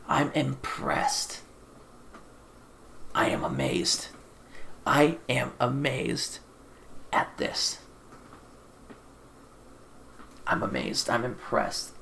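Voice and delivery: hushed, slow, breathy disbelief; stunned whisper. Real, not acted. Reviewer stunned by the film, whispering in disbelief. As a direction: let the awe drop to a whisper.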